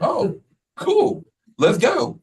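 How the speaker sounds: high, excited mock reply; eager reply. Principal greeting the diplomat at the door, high and eager. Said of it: "I'm like".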